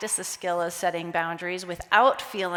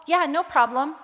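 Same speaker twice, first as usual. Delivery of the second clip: bright, higher yes to the client; mock cheerful yes; eager to please; 6 semitones higher, 4 dB louder than usual. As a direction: chirp it like a people-pleaser. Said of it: "and I would say".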